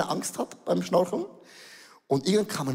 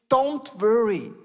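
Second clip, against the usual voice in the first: a light take on the boat guide, slow then higher; guide's reassurance; 6 semitones higher, slower than usual. Retold or imitated. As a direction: soothe the tourists, laid-back and easy. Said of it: "er hat uns gesagt". (der Bootsführer beruhigt die Touristen, lässig und entspannt)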